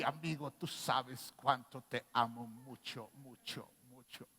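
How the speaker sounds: low, slow, drawn-out loving voice that fades away; imitating Cletus. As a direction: drag out every "mucho" low and soft like a syrupy voice note. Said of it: "Le va a llegar mi imitación a Cletus".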